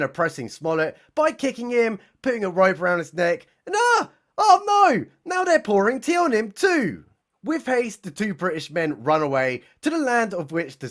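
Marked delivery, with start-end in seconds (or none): 3.6-7.0 hammed-up high gasp of alarm; mock alarm. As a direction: squeal in fake horror at the attack. Narrator alarmed at the attack.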